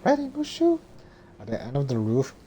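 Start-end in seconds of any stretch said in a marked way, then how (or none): 0.1-0.8 put-on high falsetto girl voice; squeaky high-pitched heroine; Mulan voice.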